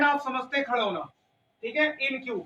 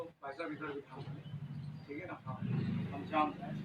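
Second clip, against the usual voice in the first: quiet aside; 7 semitones lower, 12 dB quieter than usual. Real, not acted. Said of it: "teacher aside to student".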